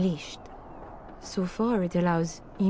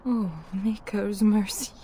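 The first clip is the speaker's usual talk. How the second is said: usual speaking voice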